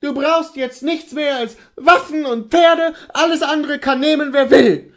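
high, loud, bitter outburst; reproachful cry. Acted. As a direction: hurl reproaches at your husband. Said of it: "rief sie".

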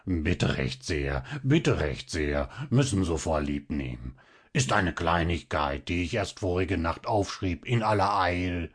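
a puffed-up little man drawling false modesty; Zinnober's nasty snarl. A narrator's reading. Zinnober accepting the praise, smug low snarl. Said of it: "mit widriger Stimme schnarchte".

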